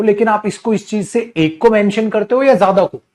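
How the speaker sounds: ordinary talk, nothing marked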